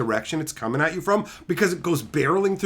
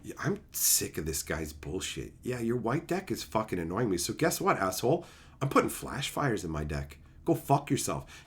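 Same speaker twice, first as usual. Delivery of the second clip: muttered grumble; 6 semitones lower, 9 dB quieter than usual. Retold or imitated. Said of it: "I remember going".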